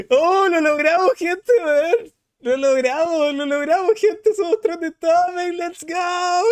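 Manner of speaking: high-pitched squeals of victory; ecstatic celebration. Real, not acted. Streamer telling followers they made trending topic, ecstatic celebration. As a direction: celebrate as if you can't believe it worked.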